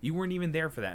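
normal talking voice

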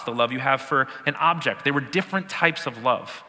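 ordinary talk

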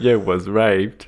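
slow, drawled Forrest Gump voice; Forrest Gump impression. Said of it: "Was that Forrest Gump?".

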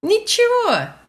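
Ничего pitched high with a smile; cheerful, high-pitched upbeat answer; upbeat, smiling. Shown as a demonstration. Teacher demonstrates Ничего as meaning pretty damn good, upbeat. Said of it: "with a smile in a very upbeat tone".

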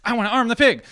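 high, loud, over-keen shout; mock overexcited yell; over-eager outburst. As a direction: blurt it out like an eager oddball. Mimics over-eager weird American.

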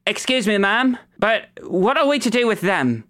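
high-pitched underling calling out; piercing voice from the crowd. Acted. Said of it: "A voice pierces the crowd".

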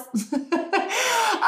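high falling giggle; embarrassed high laugh. Real, not acted. Embarrassed by her Valley Girl voice.

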